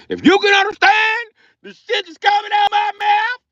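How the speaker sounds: high-pitched comic yelling; ranting shout. Acted. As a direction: shout it at the top of the range. Comic rant at non-English speakers.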